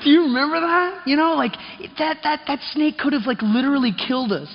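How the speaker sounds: high, eager, almost breathless disbelief; excited recall. Retold or imitated. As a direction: pitch it up, reliving the thrill.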